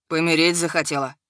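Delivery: low, even, deadly calm; level dragon voice. A narrator's reading. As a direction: ask it flat and dangerous. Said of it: "ровно поинтересовался дракон". (ровный низкий голос дракона)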